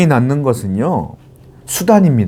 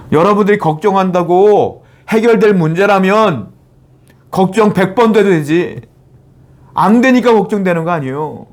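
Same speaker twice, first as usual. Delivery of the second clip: preaching at full force; 6 semitones higher than usual. Real, not acted. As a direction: press the congregation to trust. Preaching climax. (높고 힘있게 외치는 설교조)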